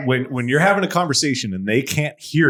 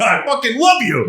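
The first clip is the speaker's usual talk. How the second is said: excited; 10 semitones higher, 4 dB louder than usual. Retold or imitated. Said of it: "and I'm like".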